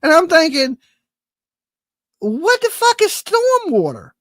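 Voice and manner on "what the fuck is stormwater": incredulous. Retold or imitated. Ranting about stormwater tax.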